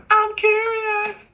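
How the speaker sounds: squeaky high-pitched slow "I'm curious"; drawn-out falsetto mockery of nosy people. Mocking nosy people in a high squeaky voice. Mock voice again.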